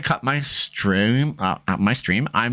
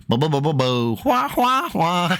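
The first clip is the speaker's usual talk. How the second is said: sung mock showdown sting, high wahs; vocalised movie-score wails; Western theme imitation; 5 semitones higher than usual. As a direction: imitate a spaghetti Western standoff tune. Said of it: "the outlaw Josie Wales".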